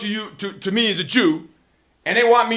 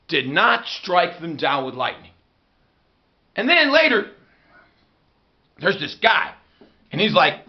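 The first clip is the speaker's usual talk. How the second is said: usual speaking voice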